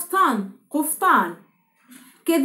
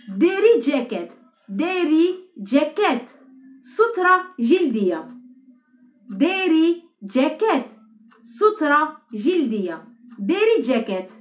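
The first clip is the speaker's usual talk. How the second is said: slow word drill; much slower than usual. Shown as a demonstration. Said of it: "teacher drills Turkish words slowly".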